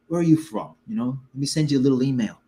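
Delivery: quick mobster-style Penguin accent impression. Recalling the actor's test footage, imitated Penguin accent. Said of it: "Colin Farrell's Penguin accent".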